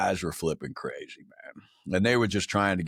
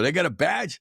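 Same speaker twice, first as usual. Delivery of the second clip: high, excited mock question; retold eager question; 8 semitones higher, 3 dB louder than usual. Grunt offered the hundred first, retold eager interest. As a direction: ask it like an eager young soldier, retold.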